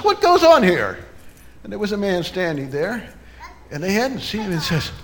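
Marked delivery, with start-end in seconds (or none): 0.0-1.0 high, loud startling interruption; sudden stranger's voice. Acted. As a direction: call out as a curious passer-by.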